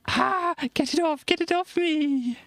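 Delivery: high, frantic mock panic; panicked. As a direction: squeal to get it off. Character panicking under sheet.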